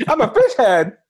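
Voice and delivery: high, laughing horror at oneself; laughing dismay. Pretend. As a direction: giggle through the shock.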